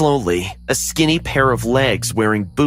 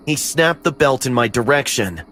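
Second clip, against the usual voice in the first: ordinary delivery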